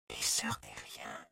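slow, very soft whisper. A narrator's reading. Camille to narrator hiding in the grass, whispering. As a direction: breathe it out slowly, barely voiced. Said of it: "a soufflé Camille".

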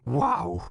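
high loud choked gasp; strangled gasp. A narrator's reading. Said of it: "d'une voix étranglée".

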